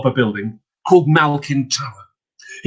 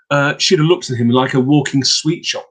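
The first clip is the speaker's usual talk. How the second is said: plain reading voice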